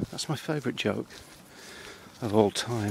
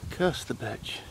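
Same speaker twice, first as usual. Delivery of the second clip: voiced but hushed, falling off at the end; soft voiced curse at the owl; quiet muttered curse; 7 dB quieter than usual. Real, not acted. Hushed curse while watching owl.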